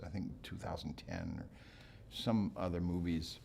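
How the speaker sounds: ordinary delivery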